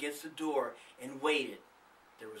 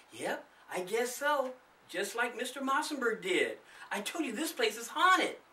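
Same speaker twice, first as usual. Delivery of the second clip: I told you so, scared; frightened; 9 semitones higher than usual. A narrator's reading. Darlene answering Charlie in the dark, mildly frightened. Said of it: "the frightened girl replied".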